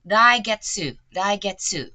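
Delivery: emphatic word. Real, not acted. Offering his word in the game.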